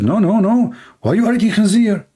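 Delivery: high, alarmed protest; horrified friend. Retold or imitated. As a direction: push back in high alarm. Friend horrified, denies eating pork.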